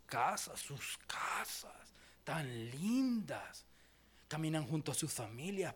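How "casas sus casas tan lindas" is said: slow, soft whisper of admiration; mock reverent whisper. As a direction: breathe it out in awe. Mocking proud homeowners.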